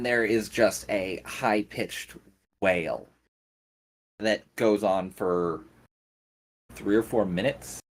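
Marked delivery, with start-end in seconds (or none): none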